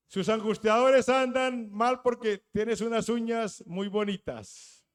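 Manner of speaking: loud, slow, drawn-out preacher's cry; fiery preaching. Acted. Preacher to his church, loud and slow fiery preaching. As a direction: hammer each line at the congregation.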